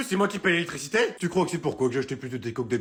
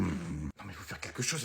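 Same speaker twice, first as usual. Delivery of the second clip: low, hushed voice in class; whispering pupil; 10 semitones lower, 10 dB quieter, slower than usual. Acted. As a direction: whisper so the teacher won't hear. Pupil whispering during exam. (chuchotement d'élève pendant le contrôle)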